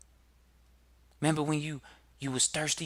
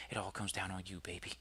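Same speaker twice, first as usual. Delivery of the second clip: much softer, lower, intimate; tender reply; 7 semitones lower, 12 dB quieter than usual. Real, not acted. Host gently answering the doubting listener, soft and intimate. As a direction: lean in and soothe them.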